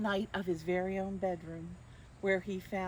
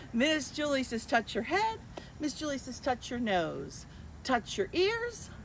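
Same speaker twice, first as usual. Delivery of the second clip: higher, bouncy game-caller voice; game leader; 5 semitones higher, 3 dB louder than usual. Acted. Miss Julie calling commands to children, playful. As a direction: keep the kids guessing. Leads Simon Says game.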